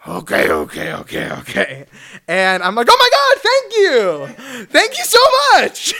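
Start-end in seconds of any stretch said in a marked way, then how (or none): 0.1-1.9 put-on low, grudging old-man okays; reluctant old man. Old Larry King caving to the crowd, low grudging okays. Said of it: "he's like". 2.9-5.9 very high, loud squealing thanks; gushing gratitude. Retold or imitated. Fan thanking Larry King, high loud squealing thanks.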